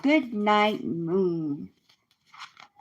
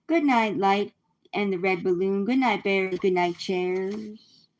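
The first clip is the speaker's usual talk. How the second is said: bedtime reading. Acted. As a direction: read it like a bedtime story. Reads bedtime book.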